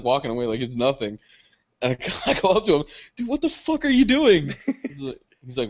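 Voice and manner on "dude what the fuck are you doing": high-pitched mock outrage; incredulous confrontation. Catching up to the friend who stole it, acted outrage. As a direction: confront a friend in disbelief, voice shooting up.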